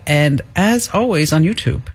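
usual speaking voice

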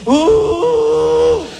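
high mock moan of pleasure; a hammed-up high ooh; pleasured ooh. Woman in bed to her man, hammed-up ooh. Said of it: "make that noise".

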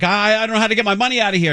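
high, fast panicky tourist imitation; mock panic. Host imitating the panicking tourist at the machine, high and fast mock panic. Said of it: "he's panicking".

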